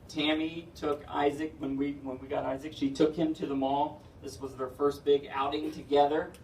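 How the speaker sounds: ordinary talk, nothing marked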